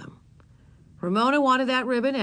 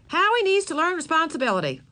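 higher, louder prim mother; tut-tutting to another mother; mother declaring a lesson, higher and louder; lecturing mother; 8 semitones higher, 5 dB louder than usual. Acted. Mother lecturing about son.

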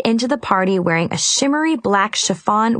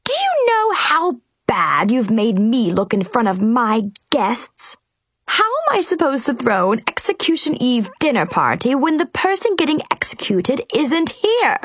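high, furious royal rant; furious Queen; 5 semitones higher than usual. A narrator's reading. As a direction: berate her like a mad queen. Furious Queen berates prisoner.

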